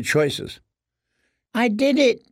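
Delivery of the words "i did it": ordinary delivery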